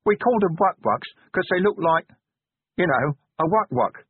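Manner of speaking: sheepish, grinning admission; a little embarrassed, half smiling; awkward grin in the voice. Acted. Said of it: "said Brick with an embarrassed grin".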